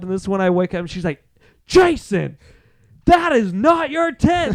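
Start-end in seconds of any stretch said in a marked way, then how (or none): 1.7-4.6 high, slow, emphatic telling-off; mock scolding. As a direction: imitate someone firmly correcting a drunk friend. Retelling Larissa scolding drunk Jason.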